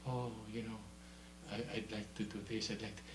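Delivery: low, very soft, quick aside; half-hearted mumbling wish. Acted. Preacher voicing a wavering believer, low and soft. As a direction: say it small and low.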